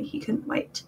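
breathy unvoiced whisper, trailing off; whispered line. Author reading her own sex scene aloud, whispered. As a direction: let the last line fall to a hush.

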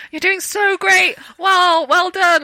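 lightly voiced kind co-star cheering her on; encouraging praise. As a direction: praise her like a proud mentor. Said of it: "he was like".

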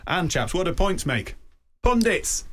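high and bright, building to a cry; playful punchline. Real, not acted. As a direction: land the pun with a flourish. Pun punchline to co-hosts.

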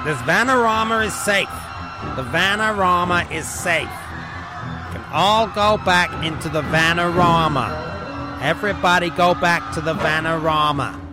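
loud, drawn-out public proclamation; rallying shout. Acted. Trailer character sending everybody back, rallying shout. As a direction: call out to a whole crowd, stretching the words.

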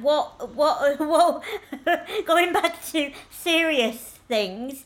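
giggling through the words; laughing. Real, not acted. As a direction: laugh while steering back to serious. Laughing while changing the subject.

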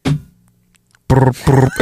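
rolled brr of a ringing phone; imitated phone ring.

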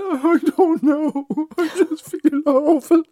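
high, slow hammed-up weepy voice of the colleague; laughing while imitating her crying; mock tearful wail. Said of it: "she said".

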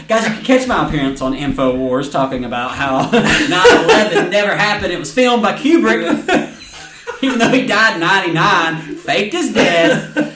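loud mock conspiracy-host plug, laughing; lightly played conspiracy ranter, cracking up; Alex Jones bit. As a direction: pitch the absurd theory loudly through laughter. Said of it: "I'm going full Alex Jones".

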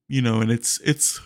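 talking through a yawn. Real, not acted. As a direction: yawn mid-sentence. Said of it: "sorry for the yawn".

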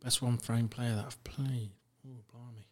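soft whisper while thinking it over; whispered to self. Real, not acted. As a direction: mutter the question back under your breath. Thinking aloud about the question.

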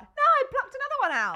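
put-on high kid voice announcing it proudly; child's voice. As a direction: imitate a proud small child. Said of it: "was just like".

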